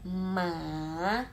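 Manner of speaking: MA drawn out, down then slightly up; "Ma" falling, then curling up; dipping third tone. Shown as a demonstration. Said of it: "turun kemudian naik di".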